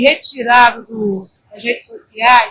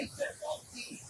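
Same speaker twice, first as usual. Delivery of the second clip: cochicho; 27 dB quieter, slower than usual. Retold or imitated. Relata falar baixinho ao vendedor.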